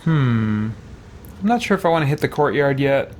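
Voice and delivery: normal talking voice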